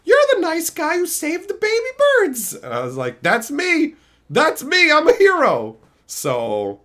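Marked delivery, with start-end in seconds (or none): 0.0-2.5 put-on high sweet old lady. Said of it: "this old lady was like". 3.2-5.8 proud hero. Retold or imitated. Proud reply to old lady.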